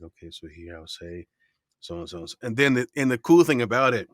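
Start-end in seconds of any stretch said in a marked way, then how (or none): 0.0-2.4 quiet rehearsal mumble. Retold or imitated. Quietly rehearsing script lines.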